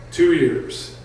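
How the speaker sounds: ordinary delivery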